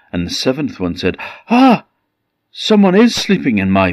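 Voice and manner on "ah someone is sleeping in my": sudden high startled "Ah!"; startled cry. Acted. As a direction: gasp out the discovery. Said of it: "And they were afraid".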